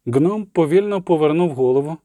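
ordinary delivery

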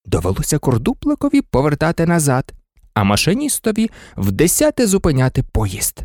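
ordinary delivery

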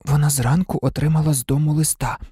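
usual speaking voice

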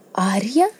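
rising, questioning call of a name; disbelieving call. A narrator's reading. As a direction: call out as if unable to believe it. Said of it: "не вірячи своїм словам, покликала вона".

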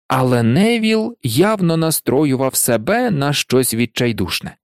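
ordinary delivery

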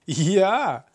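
high mocking laugh-cry; sly trickster cackling at uncle. A narrator's reading. Cunning god laughs at his uncle. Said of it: "розреготався лукавий".

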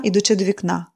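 plain reading voice

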